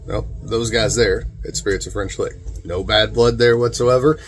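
ordinary talk, nothing marked

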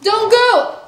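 high loud frantic begging; desperate pleading. Acted. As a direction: plead loudly, clinging on. Student begs teacher to stay.

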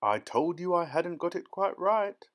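usual speaking voice

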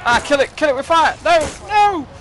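high, loud panicked shrieks; yelling for dear life at a zombie; panicked screaming. Real, not acted. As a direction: scream it out as the zombie grabs you. Zombie attacking him, panicking.

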